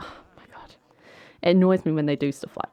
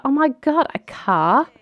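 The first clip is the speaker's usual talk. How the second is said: high-pitched excited disbelief; amazed delight; 10 semitones higher than usual. Acted. Daughter given a car for her birthday, thrilled. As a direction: jump up high in happy surprise.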